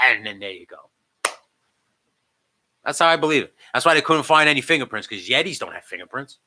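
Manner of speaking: ordinary delivery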